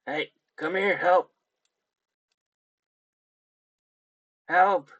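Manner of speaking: high, loud desperate cry for help; panicked yell, voice climbing. Acted. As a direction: shout for help from behind the door. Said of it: "he yells out".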